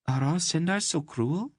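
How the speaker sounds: Mady chiding Garion playfully; reproachful girl. Acted. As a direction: tease him for being cruel. Narrator voices Mady.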